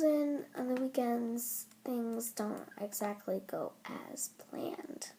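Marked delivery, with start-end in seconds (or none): 1.8-5.1 slow whispered aside; slow hushed aside. Real, not acted. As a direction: let it sink into a slow whisper. Trailing aside about school days.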